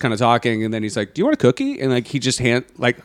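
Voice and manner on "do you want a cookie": mock offer. Retelling friend offering cookie.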